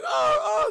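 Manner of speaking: loud, high yelping noise; cartoonish high dog cry; imitated dog yelp. Imitating the dogs in slammed door.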